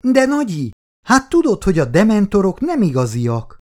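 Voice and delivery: put-on a small girl rolling her eyes at grandma; pleading little girl. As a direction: granddaughter insisting the dementors are fake, high and pleading. Child protests to grandma.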